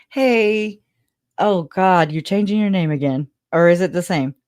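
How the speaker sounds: ordinary talk, nothing marked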